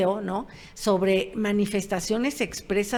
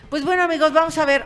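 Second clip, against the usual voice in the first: lively sign-off; 8 semitones higher, 8 dB louder, faster than usual. Real, not acted. Host's lively sign-off to viewers.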